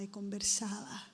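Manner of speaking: y conversaba trailing off into a whisper; fading to a whisper. Shown as a demonstration. Preacher fading conversaba into a whisper for the congregation. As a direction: whisper y conversaba as it fades away. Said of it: "y conversaba, y conversaba".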